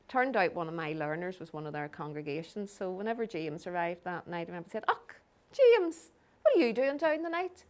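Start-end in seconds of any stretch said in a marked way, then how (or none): 4.9-7.6 high, loud acted greeting; mock surprise, pitched well up; surprised greeting. Congregant greeting James on his arrival, surprised.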